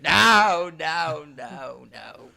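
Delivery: loud, high screamed nos fading on the last; Loomis screaming. Retold or imitated. Loomis at the end of the movie, screamed nos fading out. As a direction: yell it in horror, then drop off. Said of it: "Loomis at the end going".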